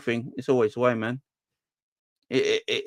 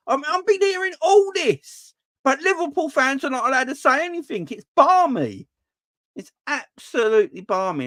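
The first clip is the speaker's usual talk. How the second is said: exasperated rant; 12 semitones higher, 3 dB louder than usual. Real, not acted. Ranting about unfair treatment.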